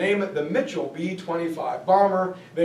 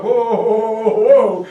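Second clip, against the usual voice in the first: louder mock-panicked whoas; alarmed whoa; 3 semitones higher, 8 dB louder than usual. Contractors cutting off Billy, loud mock alarm. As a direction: stop them, louder each time.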